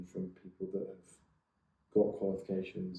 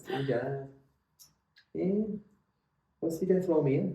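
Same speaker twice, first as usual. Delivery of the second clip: higher Liverpool Scouse voice, imitating a colleague; Scouse accent; 8 semitones higher, 4 dB louder than usual. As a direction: put on a hammed-up Scouse accent. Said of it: "she said it in a Scouse accent".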